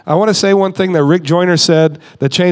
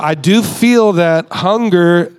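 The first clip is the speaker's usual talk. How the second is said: ordinary delivery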